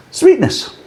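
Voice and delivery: sudden squeaky high-pitched "Sweetness"; mock-squeaky flavour call. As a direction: squeak the word like a cartoon voice.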